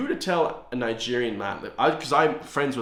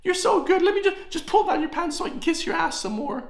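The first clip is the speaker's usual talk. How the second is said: much higher simpering falsetto; fawning journalist. Retold or imitated. Vlogger mocking the other journalist fawning over the Taoiseach, high falsetto. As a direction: squeak it like a grovelling toady. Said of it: "and then the other journalist".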